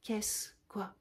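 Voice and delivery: dazed, half-whispered question; barely coming to, confused; vague voice. Acted. Cyrano coming round, vague and half-whispered. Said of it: "d'une voix vague".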